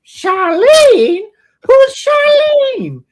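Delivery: loud falsetto mom, drawing out the question; mock a baffled mother's shriek; startled mom. Mom freezing at an unknown name, startled falsetto. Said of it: "she freezes for a second".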